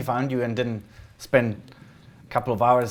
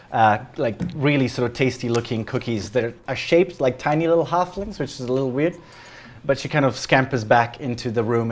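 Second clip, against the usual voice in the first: ordinary delivery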